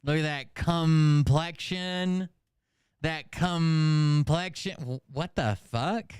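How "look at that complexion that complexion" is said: sneering hammed-up parody; mocking imitation. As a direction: stretch complexion like a vain showman. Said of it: "who would ever say it like that".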